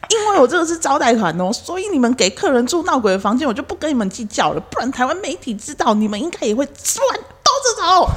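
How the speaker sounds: guest's threat. Retold or imitated. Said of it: "然后又说".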